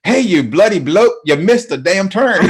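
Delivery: high, loud, drawn-out British twang; twangy British accent. Acted. Voice assistant scolding a driver, in a twangy British accent. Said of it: "the really twang in it".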